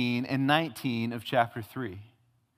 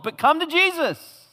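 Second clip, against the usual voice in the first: much higher, louder, urgent plea; earnest preaching climax; 12 semitones higher, 4 dB louder than usual. Real, not acted. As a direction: drive the call home at full force. True gospel call after suffering list.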